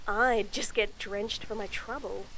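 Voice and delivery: ordinary delivery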